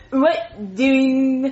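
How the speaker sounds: bad Jeffy voice. Shown as a demonstration. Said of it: "let me hear your Jeffy voice".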